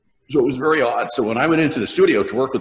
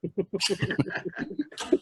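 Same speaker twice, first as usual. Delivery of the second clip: soft airy laugh; 9 semitones higher, 9 dB quieter than usual. Real, not acted. Laughing at own story.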